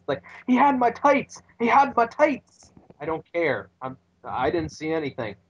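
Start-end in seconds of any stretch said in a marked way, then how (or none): 0.5-2.7 high, pleading, a touch frantic; pleading whine. Retold or imitated. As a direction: beg the ref, voice up high. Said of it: "he pleads his case".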